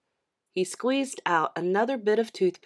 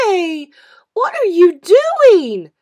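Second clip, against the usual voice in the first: put-on high, loud, slow child's demand; a little sister catching him out, loud and high; bratty kid sister calling out, high and loud; 11 semitones higher, 14 dB louder, slower than usual. A narrator's reading. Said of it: "she demanded".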